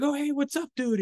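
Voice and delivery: high, bright call across the street; called greeting. Retold or imitated. Tripoli calling out to a friend at a red light, high call.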